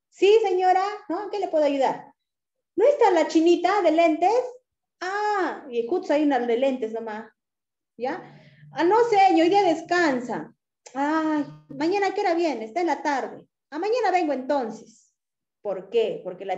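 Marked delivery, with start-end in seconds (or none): 0.1-2.1 bright high helpful clerk. Retold or imitated. Pharmacy clerk greets customer, high and eager. 2.8-4.4 higher, louder customer query; customer asking. Retold or imitated. Customer asks staff for her clerk, raised voice. 8.7-12.6 high, louder mock exchange; clerk answering. Clerk tells customer she is off today, high voice.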